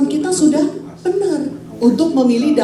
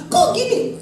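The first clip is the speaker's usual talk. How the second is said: high-pitched testy question; acted emotional complaint, voice up high; raised intonation; 9 semitones higher, slower than usual. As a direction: let the pitch jump up as in mock irritation. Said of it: "Intonasinya naik nih".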